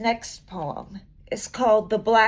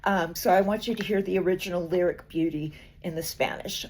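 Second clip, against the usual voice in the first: ordinary delivery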